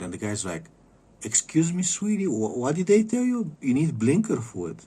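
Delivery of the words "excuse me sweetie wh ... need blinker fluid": much higher, amused sweet-talking voice; patronizing clerk. Retold or imitated. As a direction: talk down to her like a kindly shop guy. AutoZone clerk voiced in story.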